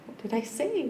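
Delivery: high, puzzled "What did I say?"; confused learner. Retold or imitated. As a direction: ask it baffled, voice lifted high. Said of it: "I'd be like".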